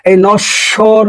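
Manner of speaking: normal talking voice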